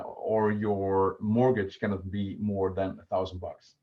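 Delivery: normal talking voice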